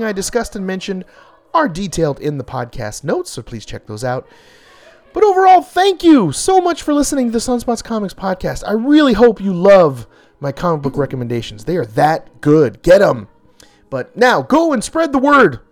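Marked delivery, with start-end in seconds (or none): 5.1-15.6 big, bold sign-off; punchy dramatic comic narration. Acted. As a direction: sell it like a comic finale.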